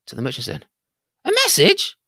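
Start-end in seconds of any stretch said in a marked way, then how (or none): 1.2-2.0 high, louder parrot question; the sweetheart parrot asking; high squawked question. Acted.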